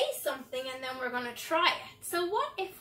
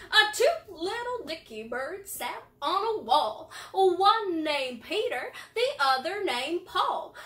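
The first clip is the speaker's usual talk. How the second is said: cowboy voice. Shown as a demonstration. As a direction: recite the rhyme like a cowboy. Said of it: "Let's do our cowboy voice".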